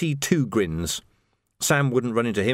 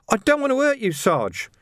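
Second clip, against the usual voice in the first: very high, pleading lad's voice; anxious junior, voiced far up; reluctant young recruit; 13 semitones higher than usual. A narrator's reading.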